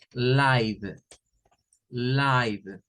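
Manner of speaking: "live" as a drawn-out laiv; long i sound. Shown as a demonstration. Teacher modelling the adjective, "live" said laiv with long i. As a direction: pronounce "live" slowly, rhyming with five. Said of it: "Y cuando es así, la pronunciación es".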